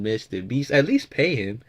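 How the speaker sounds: ordinary delivery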